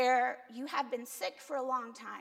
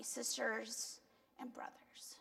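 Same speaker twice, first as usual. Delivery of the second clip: whispered tag; 9 dB quieter, slower than usual. Real, not acted. Preacher's soft tag-on.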